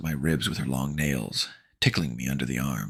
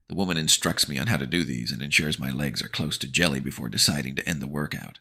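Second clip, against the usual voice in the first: ordinary delivery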